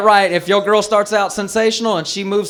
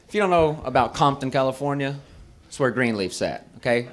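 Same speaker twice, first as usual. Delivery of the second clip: normal talking voice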